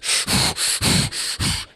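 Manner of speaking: hard, repeated breaths through a regulator; noisy panicked breathing. Shown as a demonstration. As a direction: imitate fast, heavy scuba breathing. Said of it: "it was like this".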